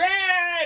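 high, loud imitated wail; drunken wail. Storyteller mimics the drunken Scotsman, high mock wail. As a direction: cry out like a hammed-up drunk.

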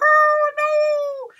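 high, loud wail of dismay; mock alarm. Storyteller reacts to scary roar, mock alarm.

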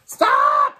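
high piercing yell; tiny ant yelling stop. A narrator's reading. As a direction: shout it out to halt them. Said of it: "yelled the smallest ant".

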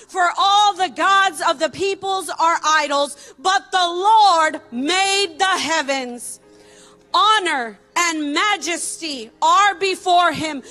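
normal talking voice

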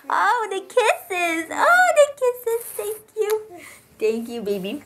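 slow, high cooing. Real, not acted. Baby kisses her.

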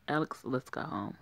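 normal talking voice

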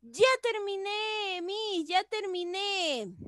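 high, drawn-out gleeful schoolchild announcement; imitating joyful pupils. Teacher imitating pupils who finished their work, joyful high call. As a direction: sing out proudly like an eager pupil. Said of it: "lo están haciendo con una alegría".

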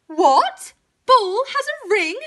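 high, loud indignant question; astonished cow outburst; shocked cow. A narrator's reading. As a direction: squeal it as a jealous cow. Cow relapses into jealous shock.

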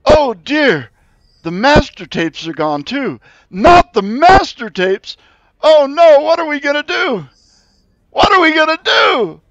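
very high, loud wailing panic; squeaky creature in full despair; distraught high creature. Acted. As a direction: wail about the lost master tapes.

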